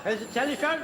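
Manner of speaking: high, laughing cry of there's a telephone; startled by the ringing telephone; excited alarm. Acted. First call of alarm, high.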